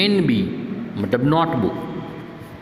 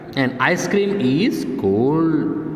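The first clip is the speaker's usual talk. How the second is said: slow dictation; much slower than usual. Shown as a demonstration. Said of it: "reads sentence slowly".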